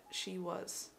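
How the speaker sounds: whispered awe. Real, not acted. Fan remembering the concert, whispering in awe. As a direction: hush it out in awe. Overcome by emotion.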